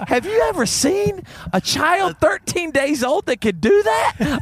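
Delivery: very high, slow, hammed-up wonder; drawn-out, high-pitched incredulous question; retold amazed challenge. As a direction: imitate her marvelling, pitch climbing high. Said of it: "she said".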